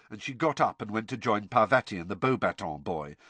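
plain reading voice

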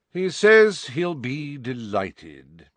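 slow, bored drawl; unimpressed portrait reporting back. Acted. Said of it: "said a bored voice".

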